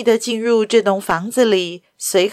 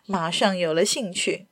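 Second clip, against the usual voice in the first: ordinary delivery, nothing marked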